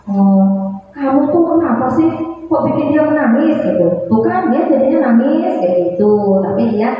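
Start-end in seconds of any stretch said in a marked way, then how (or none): 0.1-3.6 put-on high, reproachful scold to the child; scolding teacher. Shown as a demonstration. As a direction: blame the crying child with a nagging tone. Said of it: "guru tidak yang".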